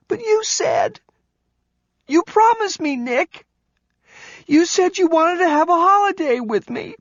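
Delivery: put-on high, tearful child pleading; upset tumbling words. Kelly pleading with Nick, upset gasping child. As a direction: let the words tumble out between gasps. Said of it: "The words tumbled out, punctuated by angry gasps for air".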